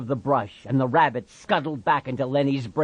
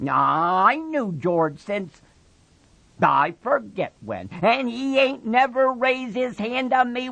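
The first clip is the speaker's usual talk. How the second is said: put-on higher, louder childlike defiance; belligerent Lenny; 12 semitones higher, 4 dB louder than usual. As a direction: push back stubbornly. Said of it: "retorted belligerently".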